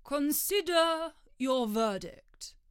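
slow, high royal command; deliberate pompous king. Acted. King addressing jury.

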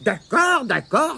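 high, then dropping, sighed consent; resigned sigh. A narrator's reading. Tirondin giving in to Mémé, sighing. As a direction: give in with a sigh. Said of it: "soupira Tirondin".

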